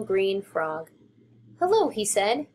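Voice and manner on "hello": small high squeaky mouse; high little mouse voice. Acted. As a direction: pitch it up like a tiny mouse.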